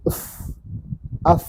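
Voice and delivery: "Af" closed on a long, vowelless f; sukun on f. Shown as a demonstration. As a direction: let "Af" end in a sustained f sound. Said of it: "Ini sukun ya".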